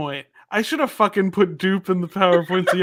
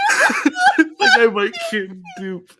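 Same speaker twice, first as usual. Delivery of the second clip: higher, slow, laughing through it; drawn-out giggly repeat; 9 semitones higher, slower than usual. Shown as a demonstration. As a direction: stretch it out with a laugh. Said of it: "repeats the line".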